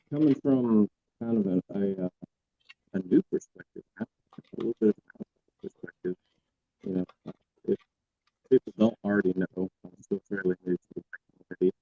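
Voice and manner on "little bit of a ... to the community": choppy, breaking-up audio; bad connection, words dropping out. Real, not acted. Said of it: "You're breaking up".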